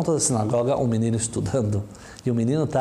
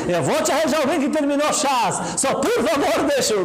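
laughing pleading. Acted. Rabbi laughing and pleading with the woman so he can sleep. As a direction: beg for sleep while cracking up. Exhausted rabbi promising a match.

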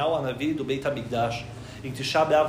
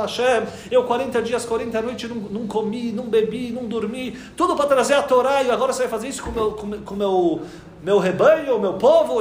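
building from raised to loud pleading outcry; rising protest; 5 semitones higher, 4 dB louder than usual. Acted. Moshe protests to Hashem over his people, building louder plea. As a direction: pile on each sacrifice, swelling louder.

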